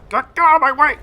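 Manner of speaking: high, loud mock shout of a frustrated tank; tank voiced shouting. Commentator voicing the pushed tank, high mock yell. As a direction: yell at the bully tank to move. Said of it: "He's trying to".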